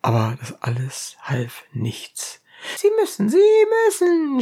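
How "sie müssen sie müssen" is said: high, insistent cry; shrill pleading. A narrator's reading. Ladies of Abdera beg Euripides, high insistent cry. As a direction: press him, high and urgent. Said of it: "schrien die Abderitinnen".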